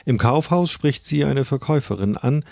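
normal talking voice

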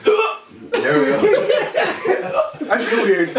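squealing laugh through the burn; high pained laughing. Real, not acted. Mouth burning from the chip.

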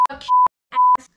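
high, loud squeal of curses; squeaky cursing yell. Shown as a demonstration. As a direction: shriek the swears out high. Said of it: "I'm just saying what he could say".

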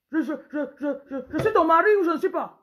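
high, loud husband, laughter breaking through; bossy husband laughing. Acted. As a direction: demand it while cracking up. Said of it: "Un pauvre autoritaire". (mari autoritaire, en riant)

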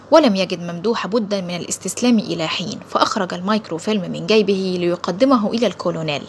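ordinary delivery, nothing marked

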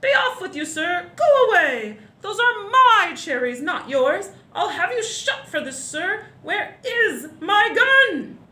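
high, loud blustering duke; outraged storybook tirade; furious shouting. A narrator's reading. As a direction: rage and bellow at the intruder. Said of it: "the Duke was shouting".

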